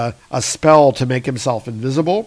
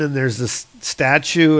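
usual speaking voice

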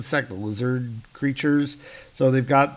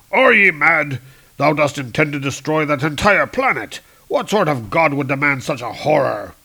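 loud indignant archaic hero; outraged Hercules; 2 semitones higher, 6 dB louder than usual. Acted. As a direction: demand it with outrage.

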